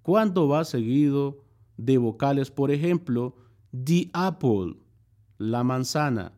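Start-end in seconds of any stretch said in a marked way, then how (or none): 3.7-4.8 "the" said as "di" before the vowel, then "apple"; slow, clear "the" pronounced "di" before "apple". Shown as a demonstration. Teacher showing Spanish speakers the vowel rule, "the" as "di". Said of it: "Y se pronuncia di".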